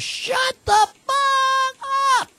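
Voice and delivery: very high, very slow screaming yell; imitating a sober old-timer screaming at a drunk; furious yell. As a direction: scream it at him like an angry bulldog. Old-timer slams table, yells.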